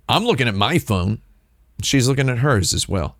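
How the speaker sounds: normal talking voice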